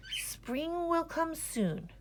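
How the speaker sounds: high, slow, announcing; boy rehearsing his forecast; rehearsed proclamation. A narrator's reading. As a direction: try out the big line.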